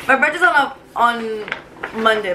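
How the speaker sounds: ordinary talk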